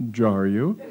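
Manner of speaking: plain reading voice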